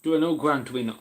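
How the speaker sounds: talking through a mouthful of food; words muffled round a bite; mouth full. Real, not acted. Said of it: "I was speaking with my mouth full".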